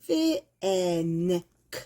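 쓰, 엔, 크 split into slow separate sounds; sounding out. Shown as a demonstration. Teacher breaking thank into sounds for students, slowly. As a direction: spell out 쓰, 엔, 크 one sound at a time, slowly. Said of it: "쓰에서 thank you 이렇게요".

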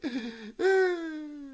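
high-pitched fake whimper; mock crying whine. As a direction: whine like a pretend crybaby. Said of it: "made it to the crying stage".

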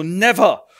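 loud, sharp refusal; a proud man refusing flat out; defiant shout. Acted. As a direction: fling the word out defiantly. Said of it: "Und er so".

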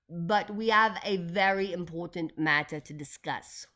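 put-on slow, firm Russian accent; Doctor Yanina Zinchenko. A narrator's reading. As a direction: press the point in a Russian accent. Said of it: "in her thick Russian accent".

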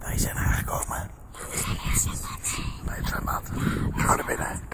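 soft unvoiced whisper. Real, not acted. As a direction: whisper each line. Whispered vlog at the pool.

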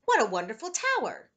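loud, high-pitched delight; admiring exclamation. A narrator's reading. Grandfather praises grandson's block tower, admiring exclamation.

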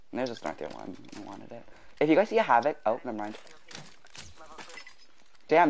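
usual speaking voice

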